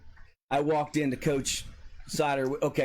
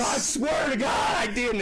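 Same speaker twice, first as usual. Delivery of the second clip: desperate protest; 10 semitones higher than usual. Retold or imitated. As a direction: swear it frantically. Protesting innocence under attack.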